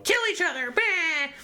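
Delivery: very high, squawking evil priestess; evil villain shriek. Retold or imitated. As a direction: screech it like a cartoon villainess. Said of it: "she says it evilly".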